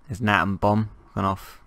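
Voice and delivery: normal talking voice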